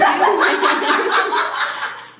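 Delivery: loud breathy laughter burst; breathy wheezing laugh. Real, not acted. Reader cracking up at the kiss scene, wheezing laugh.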